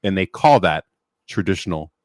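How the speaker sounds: deliberate, with pauses between phrases; slowly. Shown as a demonstration. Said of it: "I'll say this slowly here".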